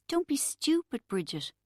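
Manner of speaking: high, strained voice near tears; holding back tears. A narrator's reading. Narrator near tears, comforting her crying friend. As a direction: fight back crying on Don't. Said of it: "I was trying not to cry".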